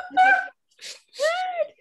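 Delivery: shocked shout. Retold or imitated. Teacher reliving her shock at the kids' answer, shouted very high. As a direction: yelp it in total disbelief.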